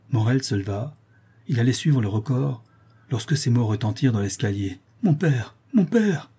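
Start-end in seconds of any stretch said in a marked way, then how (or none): 5.0-6.3 high cry, climbing on the repeat; urgent calling out. A narrator's reading. Daughter calls to her father from the stairs, urgent cry. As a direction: call out urgently from afar.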